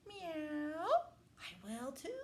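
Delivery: high cat voice; storybook cat. A narrator's reading. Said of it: "said the cat".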